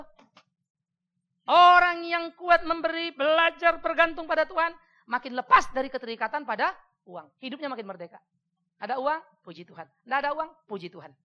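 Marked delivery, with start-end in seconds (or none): 1.5-7.3 full-force preaching. Acted. Khotbah dengan semangat penuh.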